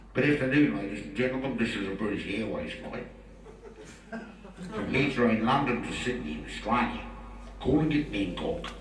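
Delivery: a hint of a calm cabin announcement; understated pilot over the tannoy; mock pilot announcement. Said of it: "You've been listening to a recorded message".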